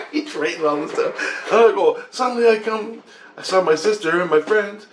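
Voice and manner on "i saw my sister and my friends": ordinary talk, nothing marked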